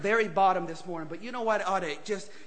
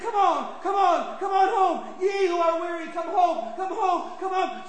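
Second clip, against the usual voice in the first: higher, shouted calling home; the shepherd calling; 10 semitones higher, slower than usual. Acted. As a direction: call the wanderer home. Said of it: "And the shepherd said".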